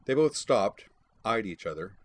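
plain reading voice

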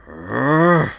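sharp high frustrated grunt; loud angry ugh of frustration; frustrated groan. Acted. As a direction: let out an exasperated ugh. Slapped the hull in frustration.

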